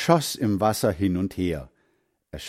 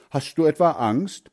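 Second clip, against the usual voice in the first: higher, teasing call; 7 semitones higher, 4 dB louder than usual. A narrator's reading. Heinz to his friend at the water, calling out a taunt. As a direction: dare a hesitant friend. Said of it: "rief Heinz".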